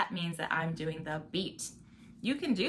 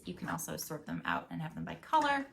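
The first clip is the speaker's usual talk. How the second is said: ordinary talk, nothing marked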